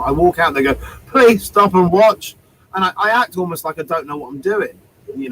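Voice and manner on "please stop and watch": loud, drawn-out plea to passers-by. Retold or imitated. Down-and-out busker calling to passers-by, pleading. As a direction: beg them slowly, voice raised. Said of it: "I play very low status when I perform".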